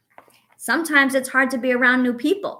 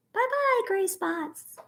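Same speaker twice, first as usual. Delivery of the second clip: cheery bye-bye; 11 semitones higher, 4 dB quieter, slower than usual. Acted. Waving the worry away.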